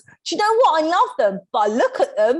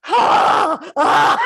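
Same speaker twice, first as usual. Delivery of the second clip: loud, high mock-panic shrieks; hammed-up freak-out before meeting a star; two loud mock screams of panic; panicked yelps; 6 semitones higher, 9 dB louder than usual. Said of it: "I was like".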